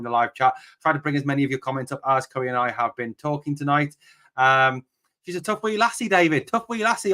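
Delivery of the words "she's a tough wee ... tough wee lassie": a mock Scottish accent, pitched higher. Shown as a demonstration. Host imitating his Scottish guest, mock Scottish accent. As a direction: try your best Scottish accent on it. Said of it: "that's my best Scottish accent I can do".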